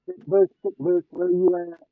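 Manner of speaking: loud playful call to a bush; hollering for a stick bush. Real, not acted. Searching, calling out to bush.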